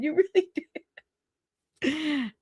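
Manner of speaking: words breaking up into laughter; high, laughing through the words. Real, not acted. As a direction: crack up while saying it. Cracked up by the comment.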